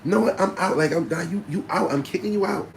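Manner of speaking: high, loud telling-off; hammed-up furious girlfriend; angry girlfriend. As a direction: kick him out as his girl.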